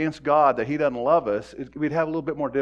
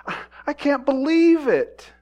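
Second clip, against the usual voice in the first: higher and louder, an incredulous outburst; mock disbelief; 11 semitones higher, 7 dB louder than usual. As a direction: throw up your hands in disbelief. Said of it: "we go".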